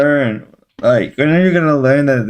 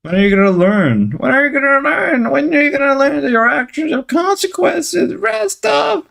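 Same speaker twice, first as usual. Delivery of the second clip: rising scold; 10 semitones higher than usual. Real, not acted.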